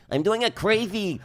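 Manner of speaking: high, loud, slow cartoon voice; crazy animal voice. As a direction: squeak it out like a cartoon critter. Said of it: "talking like a donkey".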